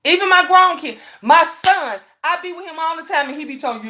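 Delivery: usual speaking voice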